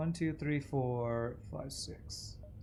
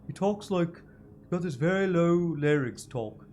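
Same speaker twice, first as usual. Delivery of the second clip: [name]; 4 semitones higher, 5 dB louder than usual. Shown as a demonstration. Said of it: "That's how [name] talks".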